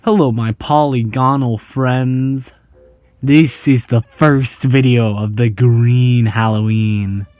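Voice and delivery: ordinary delivery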